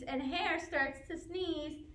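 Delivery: ordinary delivery, nothing marked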